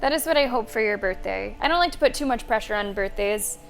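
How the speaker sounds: ordinary talk, nothing marked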